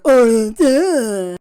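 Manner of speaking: loud, sneering "duh"; mock inner critic jeering; mocking naysayer. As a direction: scoff at her like a heckler. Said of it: "that naysayer that just makes fun of me".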